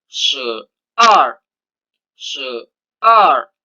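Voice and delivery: "se ar" with se falling, ar rising; Mandarin twelve. Shown as a demonstration. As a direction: model "se ar" tone by tone. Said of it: "Cara bacanya".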